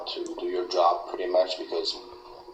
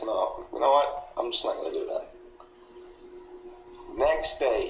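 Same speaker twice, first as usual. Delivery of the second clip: ordinary talk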